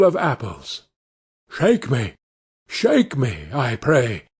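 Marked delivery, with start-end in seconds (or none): 1.5-4.2 a hint of a creaky tree asking to be shaken; tree begging the girl, only lightly voiced; tree crying out. A narrator's reading. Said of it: "cried the tree".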